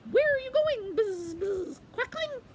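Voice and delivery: very high, loud bee voice; shrill little buzz-filled call. Acted. Beehive calling out to Quackling, very high buzzy voice. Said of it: "he heard".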